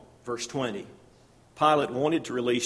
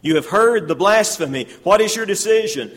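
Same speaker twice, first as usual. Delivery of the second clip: loud, raised voice of the high priest; dramatic accusation; 5 semitones higher, 9 dB louder than usual. Acted. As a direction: declaim it to the council. Dramatized high priest before council.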